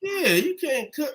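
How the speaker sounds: slow, high mocking tease. Real, not acted. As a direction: drag it out to mock her. Teasing again, slower.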